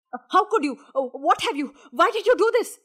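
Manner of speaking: loud, high, outraged questions; screamed accusation. A narrator's reading. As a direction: burst out in broken, shouted questions. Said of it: "screamed Rama".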